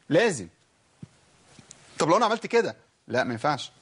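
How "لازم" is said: normal talking voice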